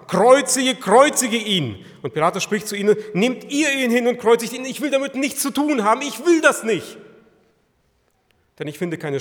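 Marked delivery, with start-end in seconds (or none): 0.1-1.8 loud, high mock crowd yell, slow on the second cry; mob shouting. Priests and servants shouting at Pilate, loud crowd cry. 3.1-7.0 high, loud, exasperated refusal; Pilate refusing. Acted. Pilate to the crowd, loud and exasperated. Pilate voiced in retold scene.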